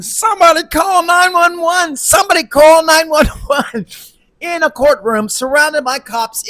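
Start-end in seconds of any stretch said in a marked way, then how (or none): 0.0-3.9 loud, high-pitched mock scream for help; imitated screaming. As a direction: scream it out, imitating a frantic caller. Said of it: "She starts screaming".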